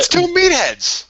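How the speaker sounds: very high, loud, drawn-out jeer; loud heckle. Real, not acted. Co-host interrupts praise of two wrestlers, loud heckle. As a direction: cut in high and slow, stretching the insult.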